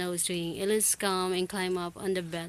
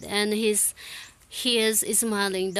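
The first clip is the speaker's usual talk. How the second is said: usual speaking voice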